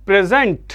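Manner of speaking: "Present" as the verb, pre-ZENT; stress on second syllable. Shown as a demonstration. Teacher showing the verb to students, "present" stressed on its second syllable. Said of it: "ये वाला part stressed होगा".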